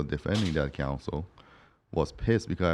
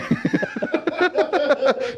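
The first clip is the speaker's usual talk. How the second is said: high-pitched burst of laughter; 3 dB louder than usual. Real, not acted. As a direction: break up laughing at the memory. Laughing at his own story.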